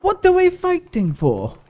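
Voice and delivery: very high, drawn-out put-on Viking voice; Vikings character impression. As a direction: imitate a Viking, slow and way up high. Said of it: "They talk like this".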